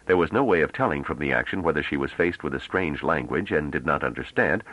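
ordinary delivery

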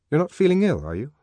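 anxious check on her health; a trifle anxious. A narrator's reading. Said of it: "a trifle anxiously".

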